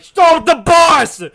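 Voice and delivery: huge, high, drawn-out yell; hammed-up scream of command; mock teacher shout. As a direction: bellow it like a furious teacher halting everyone. Teacher shouts to halt bus.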